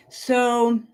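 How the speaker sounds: ordinary talk, nothing marked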